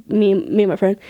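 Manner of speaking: ordinary talk, nothing marked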